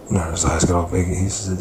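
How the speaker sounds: ordinary delivery, nothing marked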